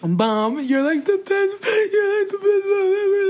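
high, squeaky fake-crying tribute; mock tearful son. Mimicking his video tribute to mom, mock tearful. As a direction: rise into an exaggerated weepy squeal. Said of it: "So he's like".

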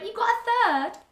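high, disbelieving mishearing; shocked question. Retold or imitated. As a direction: mum checking in surprise. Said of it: "then she went".

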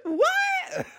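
shrieking sky-high disbelief; mother shrieking in disbelief; shocked what. Retold or imitated. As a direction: yelp what as if the news floored you. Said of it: "And Meg's like".

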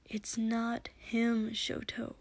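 soft, slow, near-whispered; said quietly. A narrator's reading. Izuku telling Shoto the truth, quietly. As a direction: break it to him very quietly. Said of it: "Izuku said quietly".

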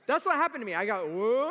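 ordinary talk, nothing marked

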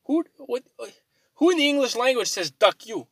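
high-pitched disbelieving rant; incredulous outburst. Retold or imitated. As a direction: ask it in outraged disbelief. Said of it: "I'm like".

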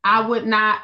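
usual speaking voice

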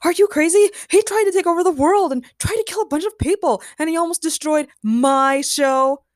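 high, loud, incredulous outrage; beside himself. A narrator's reading. Batch objecting to Nur's movie role, outraged. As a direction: rant in disbelief, slowing hard on the last words. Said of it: "Batch was beside himself".

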